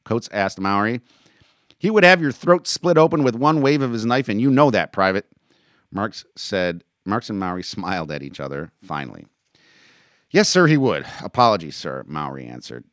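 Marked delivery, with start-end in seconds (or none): 1.8-5.3 loud, high warning; stern dressing-down. Acted. Captain dressing down a private. 10.3-12.0 loud, high response to an officer; soldier's reply. Acted. Private answers captain at attention.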